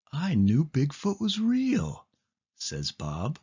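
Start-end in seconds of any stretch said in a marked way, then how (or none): 0.1-2.1 lightly voiced friend, higher; a hint of a thrilled little kid; Bob voice. Bob's line in the story.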